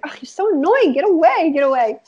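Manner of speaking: high, exasperated brush-off; annoyed big sister. Retold or imitated. Big sister to tagalong little sister, mock annoyed. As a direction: shoo the little sister away, fed up. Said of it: "I was like".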